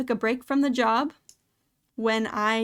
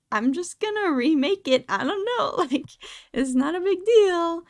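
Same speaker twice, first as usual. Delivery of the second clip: high, laughing retold shrug-off; light, exaggerated brush-off with a laugh; mock nonchalance; 7 semitones higher, 3 dB louder than usual. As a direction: play up being unbothered, pitched well up. Retells shrugging off coworkers' drama.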